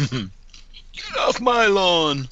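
slow, drawn-out laughing grumpy yell; laughing old man. Pretend. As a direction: stretch it out while laughing. Echoing the old man line.

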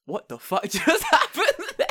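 high, loud, laughing incredulity; squealed, hammed-up astonishment; mock disbelief. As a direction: act out baffled shock, cracking up. Mother's disbelief acted out.

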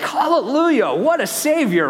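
joyful praise. Real, not acted. Preacher praising the Savior before the church, excited. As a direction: burst into praise. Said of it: "joyful exclamation".

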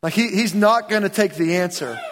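ordinary delivery

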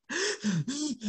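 sharp, soft gasp for breath; breathless gasp; gasp for air. Retold or imitated. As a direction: suck in air like you can't breathe. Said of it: "I could not breathe".

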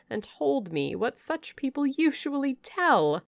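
scornful dismissal. Acted. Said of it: "returned Miss Ingram".

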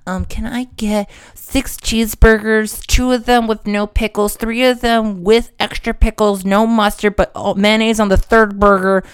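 put-on voice of a fussy fast-food orderer; drive-thru customer. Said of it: "that's what that person is doing".

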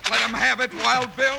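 excited yell. Acted. Cheering on during the fistfight.

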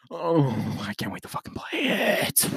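breathless whispered anticipation; excited whisper. Real, not acted. Podcaster hushed with excitement about the game, whispering. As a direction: whisper it like a secret thrill.

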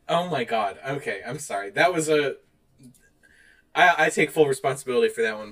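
ordinary talk, nothing marked

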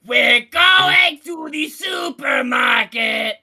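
very loud, slow, high impression voice; Gilbert Godfrey. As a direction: blare out the narration in a drawn-out comic screech. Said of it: "It's just very loud".

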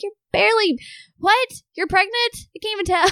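high, disbelieving retort; mock the complaint in disbelief; incredulous. Retorting to pregnant lady's complaint.

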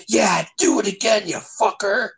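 high, wild taunting of the chef; taunting rage. Retold or imitated. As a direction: goad him, half-crazed and high-pitched. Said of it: "He's like".

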